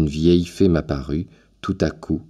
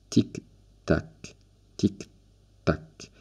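clock ticking; 7 dB quieter, much slower than usual. Retold or imitated. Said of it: "La grosse horloge ronflait".

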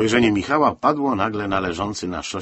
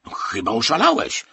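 loud, raised outraged exclamation; loud scandalised rebuke; indignant shout; 4 semitones higher, 6 dB louder than usual. A narrator's reading. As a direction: cry out in indignation. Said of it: "z oburzeniem krzyknął Stasik".

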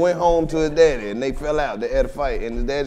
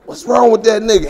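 usual speaking voice

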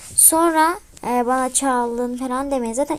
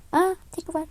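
ordinary delivery

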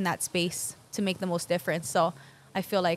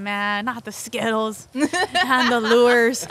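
slow, laughing mock plea; 3 semitones higher, 6 dB louder, much slower than usual. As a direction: drag it out while laughing. Pleading with thief, laughing.

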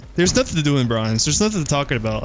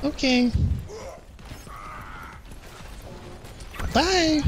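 high-pitched mock woman voice; playful falsetto as a fake woman; 8 semitones higher, 7 dB quieter, slower than usual. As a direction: put on a high, girlish voice. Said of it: "woman voice".

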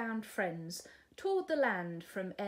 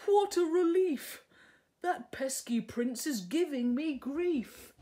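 much higher, louder, odd-toned dragon voice; relieved dragon; 6 semitones higher, 7 dB louder than usual. Acted. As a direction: blurt out relief.

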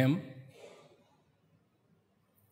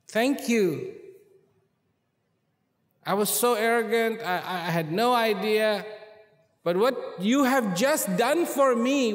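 higher, louder heartfelt thanks; fervent gratitude; 6 semitones higher, 4 dB louder than usual. Acted. Kneeling king thanking the wise man, fervent and louder. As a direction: pour out gratitude and remorse.

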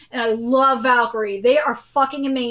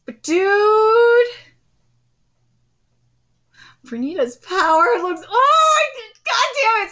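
overwhelmed excitement; 6 semitones higher, 3 dB louder than usual. Real, not acted. Hyped reaction to animation.

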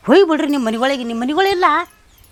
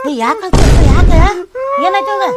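high, alarmed questions; high-pitched alarmed mother-in-law; 7 semitones higher, 5 dB louder than usual. Acted. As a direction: rush in worried about her son.

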